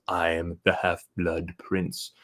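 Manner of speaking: slow hushed Snape reveal; Snape impression. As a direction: murmur it like a brooding potions master. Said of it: "Snape says".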